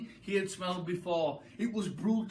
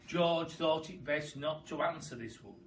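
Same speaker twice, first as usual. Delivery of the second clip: usual speaking voice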